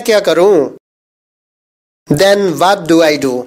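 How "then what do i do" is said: slow, careful English sentence; slow model reading. Shown as a demonstration. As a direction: say each word slowly and clearly. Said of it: "teacher says sentence slowly".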